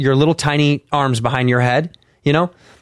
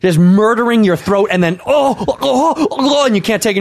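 usual speaking voice

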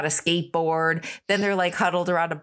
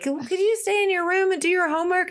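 high pleading mock mom calling upstairs; 12 semitones higher than usual. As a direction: play the mom begging from below, pitched up high. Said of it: "they're like".

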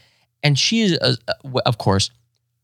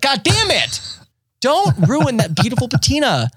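much higher, pained mock protest at the screen; mock outrage; 13 semitones higher than usual. Retelling yelling at host painting furniture, high mock outrage. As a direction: plead with the TV not to ruin it. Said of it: "I scream at the television".

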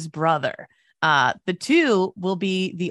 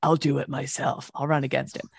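grudging resolve; 5 semitones lower, 4 dB quieter than usual. Retold or imitated. Voices the challenger brother.

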